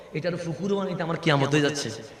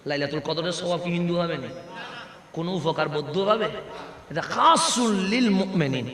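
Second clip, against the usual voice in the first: usual speaking voice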